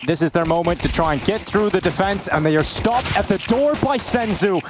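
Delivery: rising, slow, building commentary; live play-by-play. Acted. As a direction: call the final round with rising hype.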